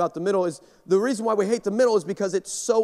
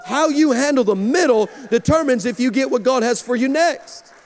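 preaching build; 4 semitones higher than usual. Acted. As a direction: rise into full pulpit fire.

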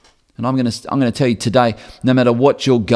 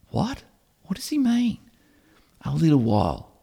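high, hushed baffled questioning; a confused follower muttering to friends; puzzled disciples; 5 semitones higher, 4 dB quieter than usual. Retold or imitated. Disciples asking one another, quietly bewildered.

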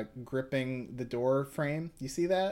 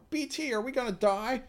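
high, panicked squeak; panicked kid; 11 semitones higher than usual. Acted. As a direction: ask it in sudden fear. Frightened Koichi asks.

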